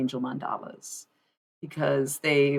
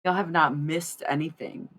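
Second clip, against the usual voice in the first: ordinary delivery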